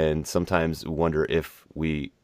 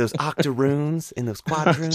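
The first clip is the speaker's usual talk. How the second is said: mock genteel lady; 10 semitones higher than usual. Continuing the mom parody.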